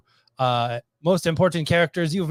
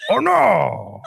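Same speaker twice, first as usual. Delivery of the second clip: lightly voiced cartoon character, a bit louder; Strong Bad impression; 4 dB louder, slower than usual. Host echoing the Strong Bad line in a light cartoon voice. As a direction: nudge toward Strong Bad without overdoing it. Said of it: "Strong Bad".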